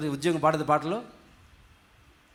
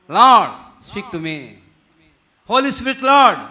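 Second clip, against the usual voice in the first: loud high call on Lord, dropping on the plea; crying out in prayer; 4 semitones higher, 9 dB louder, much slower than usual. Acted. Preacher shows believers how to ask God, crying out in prayer. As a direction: call out to God in punchy, separate bursts.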